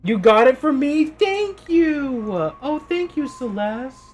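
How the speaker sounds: very high, gushing thank you; real touched gratitude. Real, not acted. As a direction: squeal thanks to a generous friend. Thanking chatter for gift.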